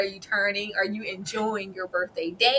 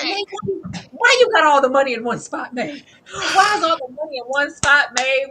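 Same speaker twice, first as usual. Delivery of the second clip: yelling at the TV; 4 semitones higher, 7 dB louder than usual. Retold or imitated. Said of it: "The way I was yelling".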